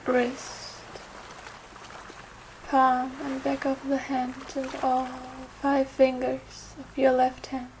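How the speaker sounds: unhurried yoga nidra guidance with long pauses; guided meditation. Acted. Yoga teacher guiding listeners through Yoga Nidra for trust, meditative. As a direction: guide them gently, phrase by phrase, leaving space.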